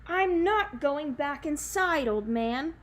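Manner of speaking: loud, slow bellow from the wolf; bellowing beast. Acted. The wolf defies the old man, a slow loud bellow. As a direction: roar it out like a beast refusing. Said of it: "the beast bellowed".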